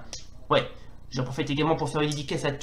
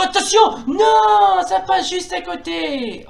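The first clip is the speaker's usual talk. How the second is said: commentator shout; 6 dB louder, slower than usual. Acted. Shot just misses.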